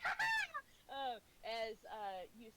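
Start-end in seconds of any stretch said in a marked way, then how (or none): 0.0-0.6 high taunting laugh; kid's gleeful laugh. Acted. Kid running with a drumstick, laughing. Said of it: "just like".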